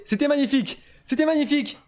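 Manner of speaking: exclamation admirative, voix haute; s'extasier après la victoire; admiration enthousiaste. Real, not acted. Félicite les vainqueurs. (admiration exaltée)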